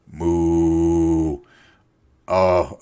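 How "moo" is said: loud, drawn-out moo; cow moo. Pretend. Player who can now be a cow, loud moo. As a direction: moo long and full like a cow.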